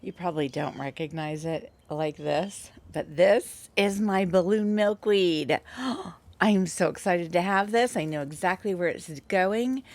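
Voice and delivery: ordinary talk, nothing marked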